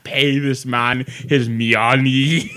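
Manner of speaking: slow, drawn-out bad Russian accent; terrible Russian accent. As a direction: quote the film in a hammed-up mock-Russian accent. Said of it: "you can quote the movie and just do a terrible gibberish accent".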